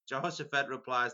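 normal talking voice

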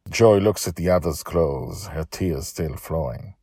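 usual speaking voice

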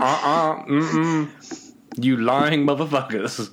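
laughing, scornful refusal; dismissive judge. Retold or imitated. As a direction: brush them off with contempt. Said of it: "He's like".

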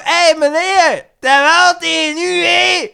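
put-on high, loud, slow drunken call across the street; mock a tipsy woman hailing a stranger. Retelling a drunk woman in Oostende calling to him, high loud slow yell. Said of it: "hoor ik haar zo roepen".

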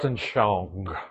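"Weltanschauung" said the German way; a low, careful German "Weltanschauung"; German word demo. Shown as a demonstration. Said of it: "how do you say that word?".

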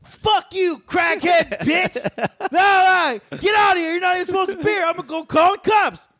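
very high hammed-up shout; mock angry yell. Friend mimics the worker yelling at the woman, very high. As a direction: chase her off in a squeaky mock shout. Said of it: "he comes out".

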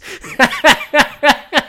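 bursting out in high laughter; real laugh. Real, not acted. Cracking up at a tweet about the ad, real laughter.

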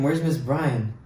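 ordinary talk, nothing marked

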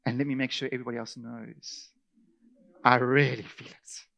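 soft self-pitying whine; lightly voiced sulker, quiet; mock wallowing. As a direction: linger in it, sorry for yourself. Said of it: "You're just going".

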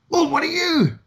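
retold parade-ground bellow pitched high; a sergeant major hollering across the square, half-acted; mock bellow. Retelling the sergeant major to recruits: high mock bellow. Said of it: "bellowed the Sergeant Major".

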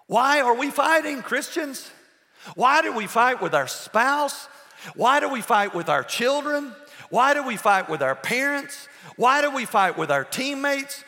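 loud, high, rousing repeated why questions; preaching at full force. Acted. Preacher to his church, rousing loud questions. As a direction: hammer each why at the congregation.

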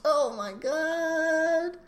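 very high, slow, drawn-out gush; gushing swoon. Retold or imitated. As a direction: squeal it slowly, melting over the romance. Swooning over a romantic moment.

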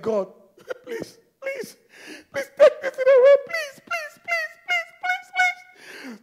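very high, whimpering plea; begging. Acted. Paul begging God for relief, high whimpered pleading. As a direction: plead in a squeaky, tearful stream of pleases. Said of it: "I begged".

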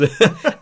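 a high, loud burst of real laughter. Real, not acted. Fan laughing while telling the podcast host, loud high laugh. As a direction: crack up at your own story. Laughing at his own story.

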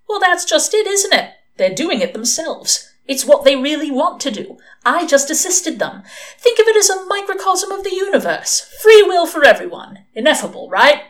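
high-pitched, insistent self-justification; defensive arguing. A narrator's reading. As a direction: argue your case loudly and hotly. Crowley argues back in quarrel.

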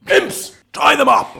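loud villain order; commanding shout. Acted. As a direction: bark the order at the imps. Villain commands his imps.

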